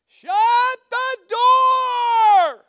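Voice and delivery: long, high-pitched holler; neighbor's yell. Retold or imitated. Woman yelling down the street at a stranger, loud drawn-out holler. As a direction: bellow it drawn out across a distance.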